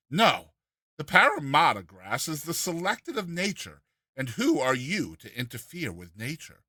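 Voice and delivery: usual speaking voice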